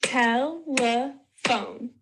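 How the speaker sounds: "telephone" slowly split as tel, e, phone; three stretched, separate beats in "telephone"; syllable by syllable. Shown as a demonstration. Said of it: "Let's try clapping these".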